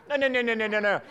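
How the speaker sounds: amused, laughing it off. Real, not acted. Laughing at a listener's remark.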